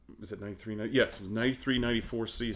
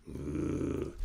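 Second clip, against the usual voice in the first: menacing grunt; 3 dB quieter than usual. Retold or imitated. Big driver glaring at you.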